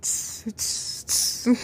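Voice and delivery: soft unvoiced tss sounds; hushed tss sounds; whispered tss. Shown as a demonstration. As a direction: hiss a tiny tss. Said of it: "You know, like".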